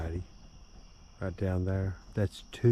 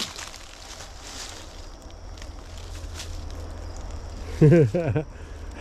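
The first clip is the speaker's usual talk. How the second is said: breathless wheezing laugh; 9 semitones higher than usual. Real, not acted. Laughing at beaver.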